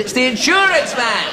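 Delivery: high, exasperated call; insurance man shouting. Acted. Insurance man answering the parrot again, high exasperated shout. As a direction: raise your voice to be heard through a door.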